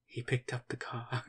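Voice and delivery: lightly done British narrator accent, soft and slow; a hint of British audio description, read slowly. Shown as a demonstration. Friend demos descriptive audio in a British accent. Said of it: "why are you making it a Brit?".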